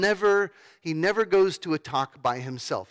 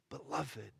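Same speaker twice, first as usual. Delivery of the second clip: soft, almost breathed out; tender; 3 semitones lower, 15 dB quieter than usual. Shown as a demonstration. Preacher repeating God's word to the congregation, soft and tender. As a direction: let the word land gently. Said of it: "That is the word of God that is near to us".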